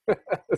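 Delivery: a quick, high amused laugh; brief laugh; short laugh. Real, not acted. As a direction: chuckle at your own joke. Laughs at own joke.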